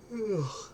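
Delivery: long audible yawn. Real, not acted. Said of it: "Sorry for yawning".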